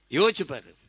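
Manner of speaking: normal talking voice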